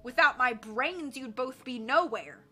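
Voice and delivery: loud, indignant put-down; angry boast. A narrator's reading. Boss tells henchmen they need him, angrily. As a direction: put them down angrily and loudly. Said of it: "Diamond sputtered angrily".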